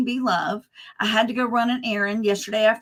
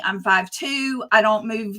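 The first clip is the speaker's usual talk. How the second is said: ordinary delivery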